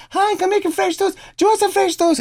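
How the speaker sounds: very high, screechy put-on wife voice; guitarist's wife. Wife calling her husband to breakfast, very high mock call. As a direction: call through the house in falsetto. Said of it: "She's like".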